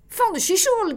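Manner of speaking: high, loud exasperated shout; angry cry. A narrator's reading. Loul fed up, shouting to his wife, furious cry. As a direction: explode in frustration. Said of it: "cria-t-il à sa femme".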